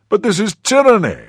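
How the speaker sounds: high, loud indignant protest; exasperated outburst. A narrator's reading. Thirsty traveller protesting at a locked cellar, exasperated. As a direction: cry it out in exasperation. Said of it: "The two gentlemen were exasperated".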